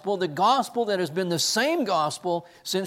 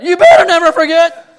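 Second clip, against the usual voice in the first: much higher, much louder mock threat through a laugh; loud warning; 13 semitones higher, 18 dB louder than usual. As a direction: bark it like a stern professor, half laughing. Professor's warning, retold.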